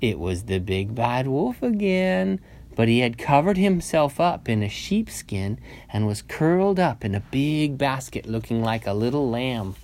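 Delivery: ordinary delivery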